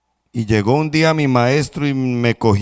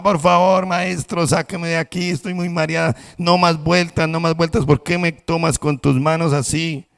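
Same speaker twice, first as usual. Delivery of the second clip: higher pleading cry of the spinning cup; dizzy cup crying out; 6 semitones higher than usual. Acted. Dizzy cup cries to its master from the wheel, higher pleading. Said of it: "yo le gritaba".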